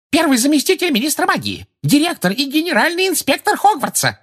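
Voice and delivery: very high, shrieky self-introduction; terrified squeal. A narrator's reading. Umbridge recites her titles to the centaurs, terrified squeal. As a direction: reel off titles in a panicky squeal. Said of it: "визгливым, насмерть перепуганным голосом".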